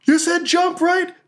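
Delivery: very high-pitched holler while leaping; orc yelling. Acted. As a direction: yell it high as you jump. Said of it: "And she yells".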